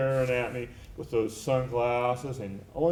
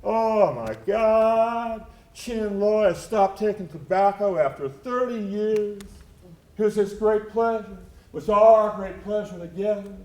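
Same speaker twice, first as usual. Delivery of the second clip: lightly voiced Papa San; 6 semitones higher, 4 dB louder than usual. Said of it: "And he said".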